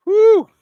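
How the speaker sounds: high, loud cheer of a woo; excited woo. Real, not acted. Host cheering the Chicago Sky to viewers, loud whoop. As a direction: whoop for your team.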